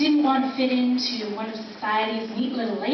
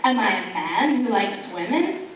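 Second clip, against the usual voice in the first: normal talking voice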